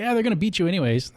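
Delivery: high, fast sneering taunt; a jeering fake friend; mock taunt. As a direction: toss it off like a smug rival. Mocking a rival's discouraging talk.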